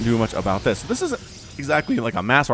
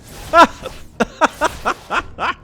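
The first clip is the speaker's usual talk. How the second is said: high giggling fit; hard laughter; 13 semitones higher, 3 dB louder than usual. Real, not acted. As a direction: laugh helplessly. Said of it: "I'm laughing so hard".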